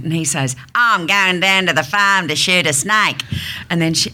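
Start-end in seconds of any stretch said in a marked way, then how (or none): 0.7-3.2 slow, broad Australian farmer's drawl; exaggerated Aussie man, vowels stretched; Australian accent. Dialect coach's example of an Australian man, broad Australian accent. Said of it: "how you say it in the Australian accent".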